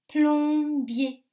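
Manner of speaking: PLOMBIER loud and high, plom held long; drawn-out syllables. Shown as a demonstration. Teacher gives children a harder word, PLOMBIER stretched out loudly. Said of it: "Dans quelle syllabe".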